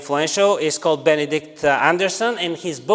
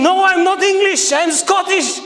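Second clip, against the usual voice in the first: much higher, louder, indignant protest; mock-exasperated visitor insisting he's Scottish; agitated Scotsman; 7 dB louder than usual. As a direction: correct them, offended and flustered. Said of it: "begins to look very agitated".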